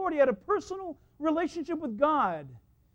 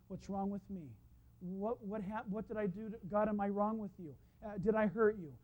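soft, halting acted questions; anxious self-doubt; 3 semitones lower, 7 dB quieter than usual. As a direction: murmur inward, as if blaming yourself. Acting inward, self-doubting questions to God.

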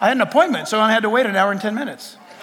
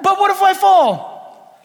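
high, loud, drawn-out mock panic; panicked protest; 10 semitones higher, 5 dB louder, slower than usual. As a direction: wail it like a scared doubter. Acts fearful believer balking.

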